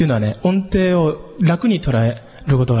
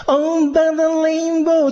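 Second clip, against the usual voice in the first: synth-like voice; 12 semitones higher, much slower than usual. Retold or imitated. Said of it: "シンセみたいな声でやる".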